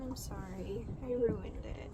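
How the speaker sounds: a quiet, broken-hearted sorry; tearful apology. Real, not acted. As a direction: barely get the words out. Apologising while crying.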